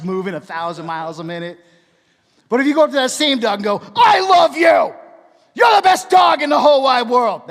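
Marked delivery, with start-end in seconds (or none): 4.0-7.5 high, full-volume yell at a dog; hammed-up scolding roar; mock angry shout. Shown as a demonstration. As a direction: shout loving words as a furious scolding. Loving words yelled at the dog.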